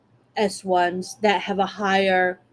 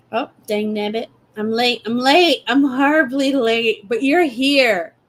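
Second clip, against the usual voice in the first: frantic late rabbit; 3 semitones higher, 3 dB louder than usual. Pretend.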